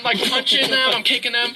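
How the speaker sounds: ordinary talk, nothing marked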